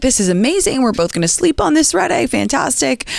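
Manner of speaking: high-pitched, gleeful retelling; delighted. Retold or imitated. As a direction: act out being thrilled with the plan. Retold delight before the flight.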